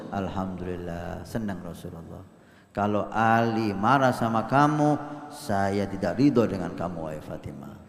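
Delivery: a gentle, grave fatherly voice; gentle solemn Prophet. Retold or imitated. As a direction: speak softly like a wise father.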